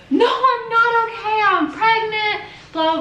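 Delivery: high, slow, wailing mock sob; imitating hysterical sobbing. Retelling her answer to the police, high wailing mock sob. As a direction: wail it out like she's crying too hard to talk. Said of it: "at this point, I'm bawling, like, hysterically".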